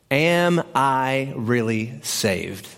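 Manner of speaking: very slow, word by word; weighty question. Shown as a demonstration. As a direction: spell out the big question slowly, deliberately. Announcing the big question.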